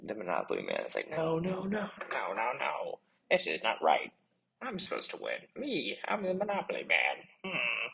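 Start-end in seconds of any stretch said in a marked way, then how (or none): 1.1-7.9 high, protesting no-no-no; whining villain. Acted. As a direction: sulk that you're meant to win. Villain losing the battle.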